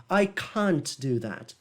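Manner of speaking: slow British "I can't do that" with a strong broad "can't"; British emphatic can't. Shown as a demonstration. Teacher imitating a British film line with an emphatic British "can't". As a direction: give "can't" a full, emphatic British vowel. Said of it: "aí a pronúncia do can't é bem forte".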